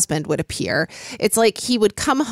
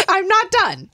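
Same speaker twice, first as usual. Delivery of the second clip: high laughing insistence; laughing, squeaky and high; giddy laughing; 11 semitones higher than usual. Real, not acted.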